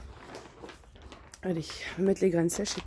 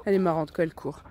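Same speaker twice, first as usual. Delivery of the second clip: ordinary talk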